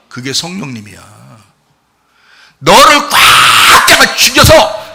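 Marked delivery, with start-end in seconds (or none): 2.6-4.9 loud, high, with a long straining 꽉; forceful squeezing shout. Shown as a demonstration. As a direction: stretch 꽉 out as if wringing hard. Preacher acts out squeezing hard.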